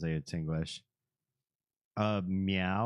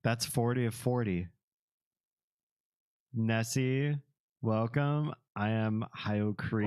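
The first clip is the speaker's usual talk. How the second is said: normal talking voice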